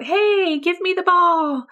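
calling high across the yard; outdoor shout. Shown as a demonstration. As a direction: shout to the friend by the fence. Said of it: "So I shout".

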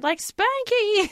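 high, slow cooing Spanky; old lady cooing Spanky. Retold or imitated. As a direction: coo the nickname like a sweet old mom. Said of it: "we get".

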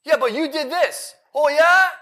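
high, defensive retort, imitating a petty comeback; mock snap back. To a yelling wife, a high mocking snap-back. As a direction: throw it back like a spouse who got yelled at. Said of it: "You snap back".

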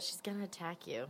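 hushed warning whisper. Acted. Warning Kai quietly.

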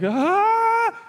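high pitched frightened yelp; a sudden high ah of fright; startled gasp. Acted. Disciples seeing Jesus as a ghost, high startled yelp.

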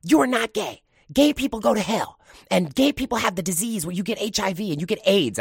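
high, loud, frantic lecturing; stern scolding mom. Retold or imitated. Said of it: "You know".

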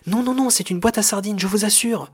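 high, loud protesting cry; crying out in denial. Acted. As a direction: insist frantically at a high pitch. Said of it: "s'écria le directeur".

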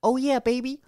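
high squawky parrot imitation. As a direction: mimic a parrot saying oh yeah baby. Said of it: "she hears".

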